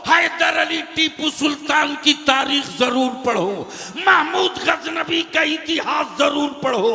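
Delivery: loud, high, ringing sermon delivery; full-force preaching. Real, not acted. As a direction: preach it out to a big crowd. (पूरे जोश में तक़रीर)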